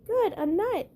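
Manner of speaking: high, glad chipmunk voice; pleased at finding supper; delighted chipmunk. Acted. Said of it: "said Chip".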